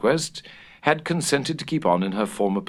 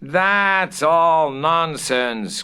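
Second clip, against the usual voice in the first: higher, louder, very slow dismissal; scoffing cry; 8 semitones higher, 5 dB louder, much slower than usual. A narrator's reading. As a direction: scoff it off loudly. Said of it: "cried Washington Otis".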